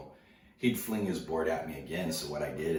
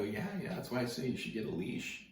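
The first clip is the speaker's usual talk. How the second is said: mock teasing; 8 semitones higher than usual. Surfer teasing the kid.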